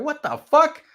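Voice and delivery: high, loud, rising protest; outraged disbelief. Retold or imitated. Man hearing his assault charge, outraged disbelief. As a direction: blurt it out in mock outrage, pitch climbing.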